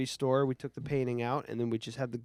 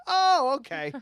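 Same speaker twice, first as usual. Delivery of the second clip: put-on much higher, louder small-boy voice, unimpressed; little kid; 13 semitones higher, 8 dB louder than usual. Mimicking the unscared little boy, much higher and louder. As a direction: shrug it off like a tiny kid. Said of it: "he was like".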